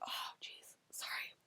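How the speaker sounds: barely audible whispered apology; real choked-up whisper, almost no voice; emotional, choked up. Real, not acted. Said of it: "I'm getting emotional".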